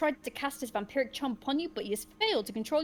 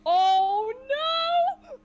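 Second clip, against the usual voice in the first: dismayed cry; 14 semitones higher, 11 dB louder than usual. Real, not acted. Vampire wins, player has no garlic.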